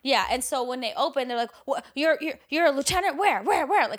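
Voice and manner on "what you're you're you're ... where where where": higher, stammered imitation of panicked officers; mock freak-out. Police discovering he's a lieutenant, mock freak-out. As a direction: mildly imitate frantic questioning, tripping over words. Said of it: "screaming at him, freaking out".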